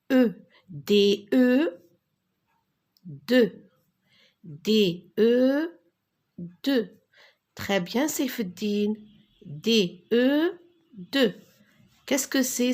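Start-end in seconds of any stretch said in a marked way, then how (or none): 0.7-7.0 D, E, then blended into "De"; teacher modelling "De" with pauses; spelling aloud. Shown as a demonstration. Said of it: "Répétez avec moi". 9.4-11.3 D, E, then blended into "De"; teacher modelling "De" with pauses; spelling aloud. Shown as a demonstration. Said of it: "Répétez avec moi".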